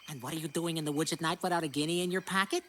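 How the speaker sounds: ordinary delivery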